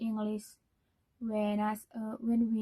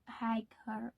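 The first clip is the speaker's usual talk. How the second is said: whispered aside; 6 dB quieter than usual. Shown as a demonstration. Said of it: "should be a little higher".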